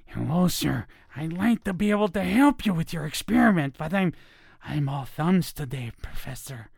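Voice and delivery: bumbling henchman character voice; Igor. Shown as a demonstration. Assistant apologizing to the professor over an experiment, Igor voice. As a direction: put on an apologetic lab assistant's voice, trailing down. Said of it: "like an Igor, Igor kind of a guy".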